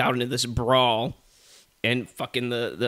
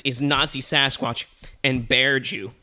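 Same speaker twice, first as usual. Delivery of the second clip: ordinary talk, nothing marked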